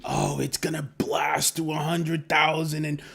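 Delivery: mock a greedy newbie; lightly voiced starry-eyed investor; naive hype investor. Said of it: "fomoed in thinking".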